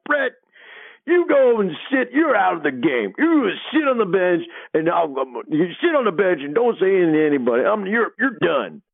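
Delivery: high-pitched imitation of an irate head coach; Coach Sutton. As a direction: bench a player in a strained, high scolding voice. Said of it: "Coach Sutton, like, spins around".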